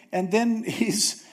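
ordinary talk, nothing marked

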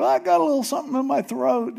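high, fast, nervous excuse; squeaky excuse. Retold or imitated. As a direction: cover up quickly with a squeak. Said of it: "And Jacob".

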